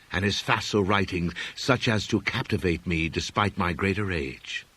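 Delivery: ordinary delivery, nothing marked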